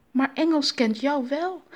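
playful tease; joking. A narrator's reading. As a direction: crack a little joke. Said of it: "grapte Anansi".

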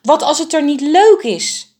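high, loud panicked cry; calling out. A narrator's reading. A frightened bird cries out to the flock, high and loud. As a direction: call out in alarm across the tree. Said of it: "riep een ander".

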